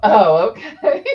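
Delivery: slow, loud laughing oh okay; laughing relief. Real, not acted. Laughing at paper plates arriving.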